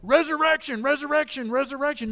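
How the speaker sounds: high, loud cries of Resurrection, fading; excited shouting. Retold or imitated. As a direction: run out shouting the news, then ease off. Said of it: "running from the tomb going".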